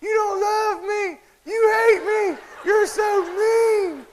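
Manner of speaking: very high, drawn-out mock wail; hammed-up child's tantrum in falsetto; screaming, crying girl. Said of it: "screaming, crying".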